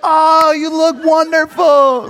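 high, drawn-out gushing shout; mock spectator praise, stretched out; yelled cheering. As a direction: gush loudly at a struggling runner. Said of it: "the whole time yelling".

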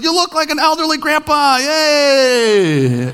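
high, drawn-out cheering holler; hammed-up new-dad yell. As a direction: cheer the wrong thing at a newborn. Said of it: "You're yelling out".

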